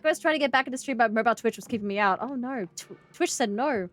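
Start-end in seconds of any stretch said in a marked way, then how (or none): none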